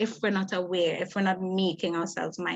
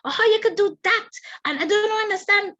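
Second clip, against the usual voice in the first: much higher, rising to loud accusing outburst; mock accusing parent; 11 semitones higher, 3 dB louder than usual. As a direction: nag the kid as an exasperated parent would. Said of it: "We say".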